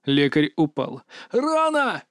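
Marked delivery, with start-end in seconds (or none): 1.3-2.0 very high, drawn cry; wounded cry. A narrator's reading. Medic shot while running, high wounded cry. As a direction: cry out high, without full volume.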